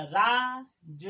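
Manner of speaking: राज drawn out very slowly; slow, held राज; stretched long aa. Shown as a demonstration. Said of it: "रा पे बड़ा की मात्रा".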